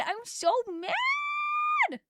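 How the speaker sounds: very high, slow stretched wail; high, drawn-out mock tantrum shout; mock mad wail. Real, not acted.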